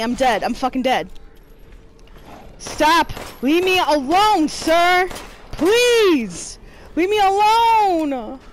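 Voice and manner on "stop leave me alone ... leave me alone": high, loud begging; frightened pleading. Real, not acted. Gamer begging the monster to leave, scared. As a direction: plead with it in fear.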